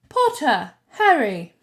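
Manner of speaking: loud, falling call across the hall; called out name. A narrator's reading. Teacher calling the next student, loud call.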